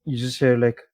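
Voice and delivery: usual speaking voice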